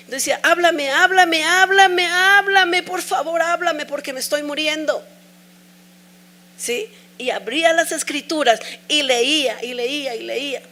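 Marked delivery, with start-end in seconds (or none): 0.4-5.0 retold cry of despair, voice pitched up; desperate pleading. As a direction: beg as if dying inside. Desperate plea to God retold.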